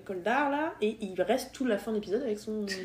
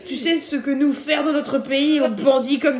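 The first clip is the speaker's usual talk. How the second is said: slow, laughing fake Russian accent; Russian accent in French; 4 semitones higher, 8 dB louder, slower than usual. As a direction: ham up a Russian thug in French. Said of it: "il parle avec un accent russe". (accent russe caricatural en riant)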